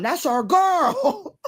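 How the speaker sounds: high, very slow tease; sarcastic fondness. Real, not acted. Mocking a familiar company name, slow and high. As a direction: drawl it out mockingly. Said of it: "fond sarcastic remark".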